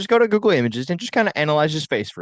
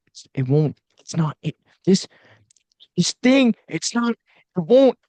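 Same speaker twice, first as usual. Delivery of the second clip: high, loud flustered British mumble; British accent impression; 6 semitones higher, 5 dB louder than usual. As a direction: squeak out a frustrated British tech complaint. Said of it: "But of course, he's British".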